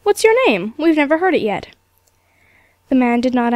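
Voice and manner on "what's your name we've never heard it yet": sudden question. A narrator's reading. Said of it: "turning round suddenly upon him".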